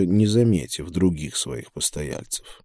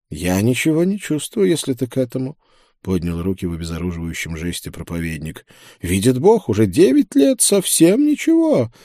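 joking preacher; 2 semitones higher than usual. Acted. Voices the preacher in dialogue. (шутливый проповедник, высоким голосом)

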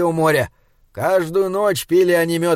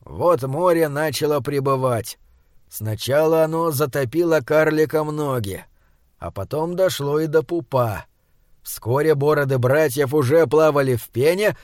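plain reading voice

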